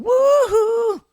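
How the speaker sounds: very high, loud woo-hoo; imitated crowd woo-hoo. Teacher imitating the class's reaction, high loud whoop. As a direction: whoop like a whole audience. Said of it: "They're going".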